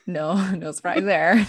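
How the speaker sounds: laughing awkwardly through words. Real, not acted. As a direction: chuckle out the line. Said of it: "without laughing".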